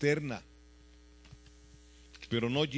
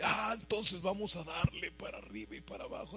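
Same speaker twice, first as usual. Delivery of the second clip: a lightly voiced, flippant imitation of careless believers; mocking careless believers; 5 semitones higher than usual. Preacher mocking careless believers, with a light flippant imitation. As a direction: toss it off like someone who takes grace lightly. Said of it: "así dicen muchos".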